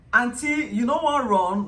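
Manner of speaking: loud high call to a passenger; Lagos bus conductor shout. Retold or imitated. Bus conductor hurrying an auntie, loud and high. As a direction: hurry her onto the bus.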